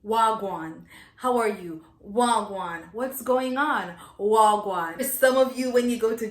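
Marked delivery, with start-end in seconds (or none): 0.1-0.8 Wagwan in Jamaican patois. Shown as a demonstration. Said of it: "First rule to speaking Jamaican". 2.1-2.8 Wagwan in Jamaican patois. Shown as a demonstration. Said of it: "First rule to speaking Jamaican".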